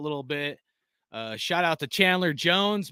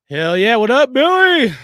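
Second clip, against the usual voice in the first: excited shout-out; 6 semitones higher, 11 dB louder than usual. Real, not acted. Greeting a chatter excitedly.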